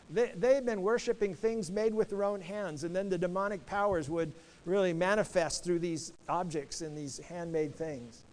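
usual speaking voice

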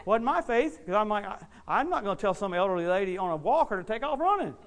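loud, high, building testimony; full-force preaching. Real, not acted.

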